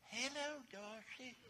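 high, thin, feeble call; weak little falsetto cry for help; voiceless call. Acted. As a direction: call out with no voice left. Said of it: "I don't have a voice".

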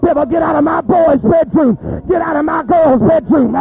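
loud, high shouted exhortation; full-force preaching. Real, not acted. Preacher ordering the devil out of his house, shouting.

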